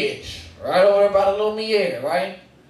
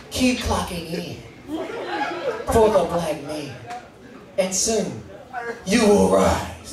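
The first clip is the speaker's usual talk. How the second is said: poetic recital. Pretend. Maya Angelou reads a poem.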